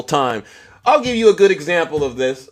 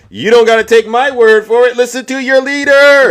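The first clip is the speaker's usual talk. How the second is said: rising shout; 9 semitones higher, 8 dB louder than usual. Real, not acted. Streamer shouting to viewers, loud and high. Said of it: "But get this".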